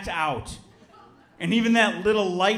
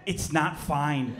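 slow deadpan; 5 semitones lower, 6 dB quieter, much slower than usual. Retold or imitated. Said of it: "I go".

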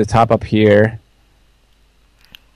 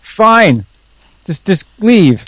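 mock huffy; 8 semitones higher, much slower than usual. Mock quarrel, sending friend away.

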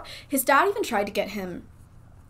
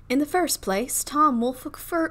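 put-on Southern debutante accent; 5 semitones higher than usual. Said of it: "in a Southern debutante accent".

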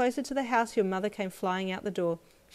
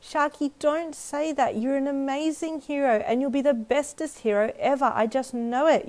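put-on high, emphatic child's declaration, growing louder; triumphant yell; 7 semitones higher, 5 dB louder than usual. A narrator's reading. Girl rallying her friend, triumphant yell. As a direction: proclaim it with total faith. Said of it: "you yelled triumphantly".

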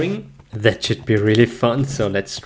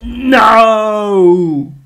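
loud, high cry of protest; frustrated shout; 8 semitones higher, 8 dB louder, much slower than usual. Real, not acted. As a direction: yell out in frustration. Horse lost, frustrated.

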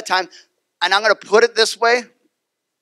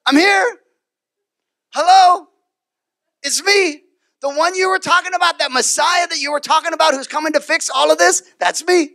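higher, brighter grand reveal; playful reveal; 8 semitones higher than usual. Retold or imitated. Jesus revealing himself to the woman, playful and higher. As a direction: announce yourself with glee.

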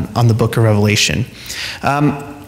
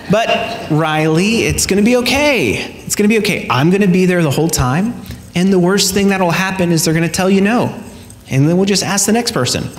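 reassuring dad; 6 semitones higher than usual. Retold or imitated. Dad comforting crying daughter.

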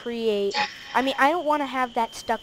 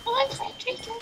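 witch voice; 12 semitones higher, 11 dB quieter than usual. Retold or imitated. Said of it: "Do that again".

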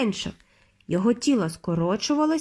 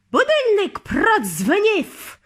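high, slow, triumphant screech; witch shrieking; 10 semitones higher, 4 dB louder, slower than usual. A narrator's reading. Grand High Witch shrieks that the alarm went off. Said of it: "вирискнула верховна відьма".

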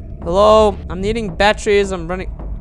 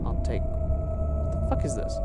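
whispering; 10 semitones lower, 11 dB quieter than usual. Real, not acted. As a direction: breathe it out under your breath. Puzzling over item in game.

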